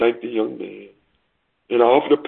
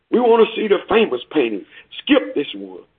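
higher-pitched call from the crowd; lightly acted shout, pitched up without much added volume; mild shout; 8 semitones higher than usual. A heckler in the back of the room, mild shout. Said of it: "a voice in the back of the room shouted".

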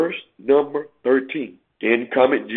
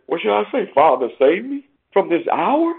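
impassioned preaching; 9 semitones higher than usual. Acted. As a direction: voice soaring much higher, urgent. Preaching climax on Jesus' suffering.

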